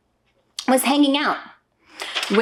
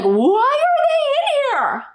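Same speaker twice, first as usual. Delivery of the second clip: put-on high, slow, puzzled child's voice, much higher; puzzled little kid; 3 dB louder, slower than usual. Mom imitating her kid asking in the bathroom, high and puzzled. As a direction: play a confused little kid, drawn out.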